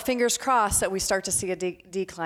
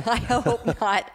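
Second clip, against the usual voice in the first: slow, laughed-out reply; laughing; 4 semitones higher, 4 dB louder, slower than usual. Real, not acted. Guest answering host's question on soaring prices, laughing. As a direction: say it through a laugh.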